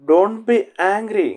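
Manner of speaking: each word drawn out slowly for learners; a teacher modelling the phrase slowly for the class; slow model reading. Shown as a demonstration. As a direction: say it slowly so students can follow. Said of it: "teacher repeats phrase slowly".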